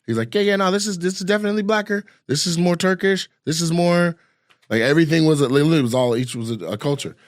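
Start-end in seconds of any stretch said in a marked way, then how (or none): 0.3-4.2 lightly voiced driver, pitched higher; imitated driver. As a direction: quote the driver in a raised voice. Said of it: "I was asking the driver. He's like".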